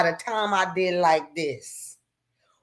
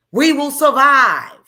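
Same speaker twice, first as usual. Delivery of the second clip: We will survive shouted much louder, stress on We; loud defiant vow; 6 semitones higher, 10 dB louder than usual. Real, not acted.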